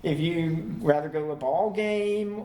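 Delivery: normal talking voice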